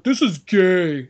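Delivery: slow, drawn-out bro voice; mock a dumb bro, dragging it out; lightly voiced jock sneer. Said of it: "a bunch of bros like".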